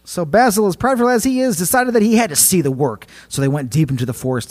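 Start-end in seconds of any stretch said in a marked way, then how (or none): 0.0-2.3 raised, higher storyteller voice; dramatic storytelling. A narrator's reading. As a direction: tell it big and bright. Restarts the line louder, higher.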